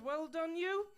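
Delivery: bright rising well done; chirpy pat on the head; mock cheery praise. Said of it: "I just said".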